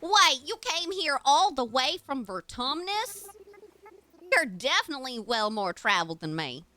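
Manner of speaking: put-on lilting character accent, swooping pitch; exaggerated villager accent; Bertha's folksy accent. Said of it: "she talks like this".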